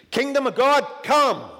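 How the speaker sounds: loud, high commanding call; proclaimed command. Shown as a demonstration. Preacher proclaiming the prayer, loud command. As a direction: declare it like a command. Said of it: "is the way we're supposed to pray this".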